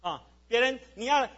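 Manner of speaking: ordinary talk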